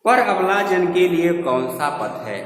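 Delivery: high, loud opening that falls away; verse recitation. Shown as a demonstration. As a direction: declaim the line of poetry. Reciting a verse before explaining it.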